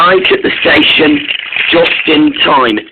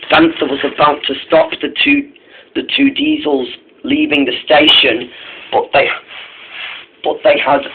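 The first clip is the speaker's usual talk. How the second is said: usual speaking voice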